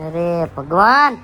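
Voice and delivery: low, slow weary "અરે ભગવાન"; exasperated father groaning at his daughter. Acted. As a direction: sigh it out like a fed-up father.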